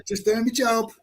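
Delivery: a put-on voice, sneering; mock the excuse in a different voice; mimicking a Nazi's excuse, sneering; sneering mimicry. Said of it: "the fucking geezer ... said".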